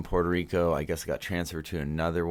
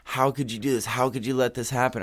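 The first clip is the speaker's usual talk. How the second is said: higher, mildly raised mock scolding; retold scolding yell; 8 semitones higher, 3 dB louder than usual. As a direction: replay the doctor scolding a patient. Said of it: "Puerto Rican doctor is yelling at me".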